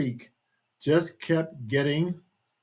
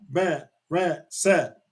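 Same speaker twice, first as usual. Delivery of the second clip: plain reading voice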